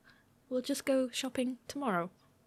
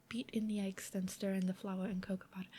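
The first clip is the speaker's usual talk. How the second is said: softer, half-whispered recipe step; half-whispered reread; 3 semitones lower, 6 dB quieter than usual. Real, not acted. Rereading the recipe to herself while baking, half-whispered. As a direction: murmur the step to yourself while checking. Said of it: "rereads step half-whispered".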